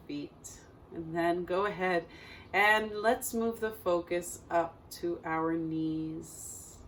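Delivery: calm meditation guide with long, unhurried pauses; guided meditation. Acted. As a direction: guide listeners gently through breath and body, leaving space between phrases.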